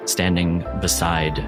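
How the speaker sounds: usual speaking voice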